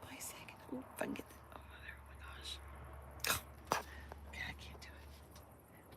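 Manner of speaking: soft coaxing whisper to the dog. Real, not acted.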